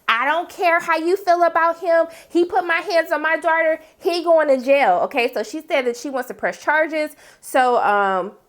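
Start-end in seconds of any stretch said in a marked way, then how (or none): 0.1-5.0 fierce mom. Retold or imitated. Maddie's mom demands charges, high and insistent. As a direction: lay down the law like an angry mother. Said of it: "She was like".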